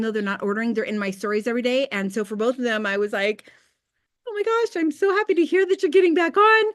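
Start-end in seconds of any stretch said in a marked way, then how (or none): 4.3-6.6 forced bubbly gush, higher, louder and fast; fake cheer. Answering former customers who re-signed elsewhere, with forced high cheer. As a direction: gush with overdone delight that hides the hurt.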